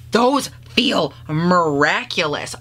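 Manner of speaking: slow, savoring awe; slow dreamy awe at the texture; awed delight. Real, not acted. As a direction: let each word melt, amazed. Swatching shimmers in awe.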